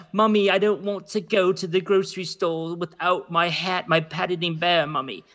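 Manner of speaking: mock-prissy British little-bear voice; Paddington Bear. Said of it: "I sound more like the Paddington Bear".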